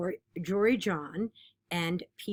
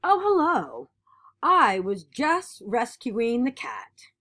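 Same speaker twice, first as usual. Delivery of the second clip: lively, expressive picture-book reading; animated read-aloud of a kids' book; reading to children; 2 semitones higher, 5 dB louder than usual. Acted. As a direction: read it like storytime for a class.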